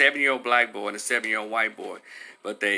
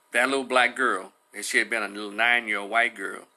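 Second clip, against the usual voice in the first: normal talking voice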